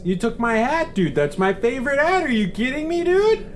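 high, loud outraged yelling; angry shout. Acted. Harold yells at Geraldo over his stolen hat. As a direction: demand your hat back.

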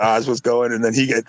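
usual speaking voice

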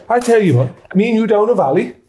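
put-on slowing into an Irish-accented threat. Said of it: "That's Irish as well".

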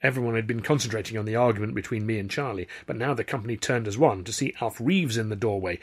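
plain reading voice

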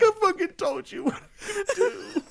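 high, laughing I-told-you-so; half-laughing scold at a friend; laughing yell, pitch way up; exasperated yell. Retold or imitated. Yelling at Ross about the lion.

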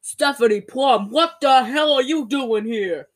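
slow, drawn-out man's line; hammed-up slow demand; mock confrontation. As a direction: act out his surprised challenge, stretching the words. Voicing Joe Morelli confronting her.

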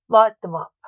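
loud barked order to the guards; commanding shout. Acted. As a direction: shout it across the execution yard. Witch finder orders the burning.

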